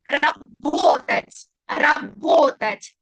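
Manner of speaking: Работать drawn out slowly, with the stress on the second a; Работать very slow, stress on ra-BO-tat; slow stressed demo. Shown as a demonstration. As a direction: stretch out Работать syllable by syllable for the students. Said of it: "tilde na o".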